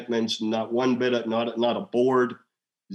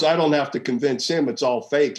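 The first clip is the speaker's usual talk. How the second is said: ordinary talk, nothing marked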